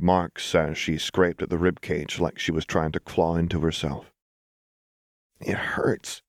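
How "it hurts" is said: whispered, hurt mutter. A narrator's reading. Jinx admitting to Lux that she is hurting, muttered. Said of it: "Jinx muttered".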